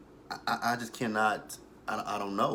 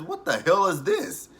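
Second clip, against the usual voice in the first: higher, louder, drawn-out disbelief; mock bafflement; 7 semitones higher, 5 dB louder than usual. [name] looking at Quita's scribbled pad, loudly baffled. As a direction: imitate someone stunned by nonsense.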